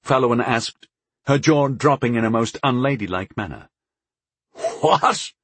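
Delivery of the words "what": high-pitched, incredulous what; chortling disbelief. A narrator's reading. Onlooker hears the knight's proposal, high and incredulous. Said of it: "chortled Lorcus in disbelief".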